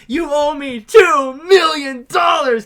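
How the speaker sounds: loud, slow, high mock yell; hammed-up drawn-out movie shout; Kano. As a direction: bellow each word like a cheated villain. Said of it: "he's like".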